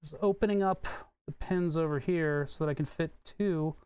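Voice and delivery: normal talking voice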